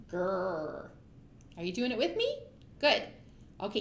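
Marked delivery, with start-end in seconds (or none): 0.1-0.9 mock troll growl.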